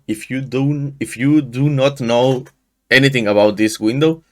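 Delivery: usual speaking voice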